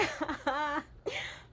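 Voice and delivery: high, loud sobbing laugh; tearful laugh-sob. Real, not acted. After sharing the happy ending, a tearful laugh-sob. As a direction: laugh through real tears.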